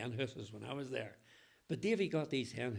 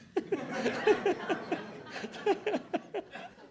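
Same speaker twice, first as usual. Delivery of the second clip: loud laugh; 6 dB louder than usual. Real, not acted. Laughing at his own joke.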